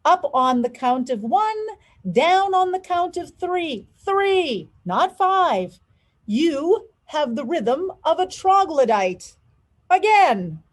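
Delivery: exasperated teacher yelling counts at a student. A narrator's reading. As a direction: bark corrections across the studio. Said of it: "Mister Debato yelled".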